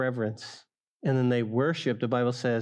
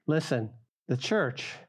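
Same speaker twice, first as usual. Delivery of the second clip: normal talking voice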